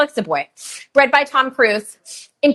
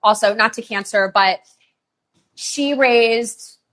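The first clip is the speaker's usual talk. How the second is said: ordinary delivery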